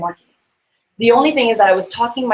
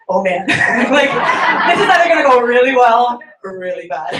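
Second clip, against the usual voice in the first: nervous laugh; 2 semitones higher, 4 dB louder than usual. Retold or imitated. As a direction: laugh through the nerves. Nervous pulling up to house.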